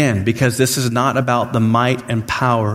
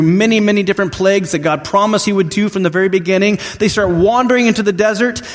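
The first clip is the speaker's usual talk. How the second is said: raised, fast preaching push; preaching at full force; 7 semitones higher than usual. Acted. Preacher rallying the congregation through the Exodus, fervent and fast. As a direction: drive the story home to the congregation.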